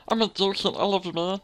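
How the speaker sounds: high, silly mock voice; put-on friend voice. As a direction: gush it high and goofy. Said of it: "I always thought his voice was like".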